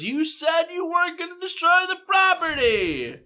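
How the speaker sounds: high, slow indignant cop voice; policeman. Acted. Policeman behind the party scolds them, high and indignant. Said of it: "The policeman from behind you goes".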